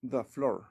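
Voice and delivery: "the floor" with "the" as "da"; "the" before consonant. Shown as a demonstration. Teacher gives an example, "the floor" said "da floor". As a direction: say "the floor" as "da floor", tapped r. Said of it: "Sería como the".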